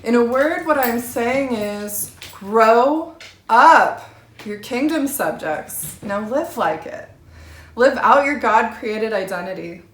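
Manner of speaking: loud and rising, calling the hearers to grow up; fervent preaching. Real, not acted. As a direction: preach it at full force.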